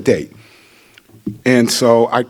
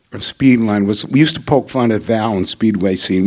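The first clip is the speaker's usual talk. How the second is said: ordinary talk, nothing marked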